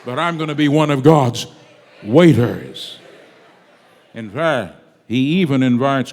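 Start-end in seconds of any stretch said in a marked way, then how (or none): 0.0-3.0 preacher's defiant shout. Retold or imitated. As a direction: proclaim it defiantly like a battle cry.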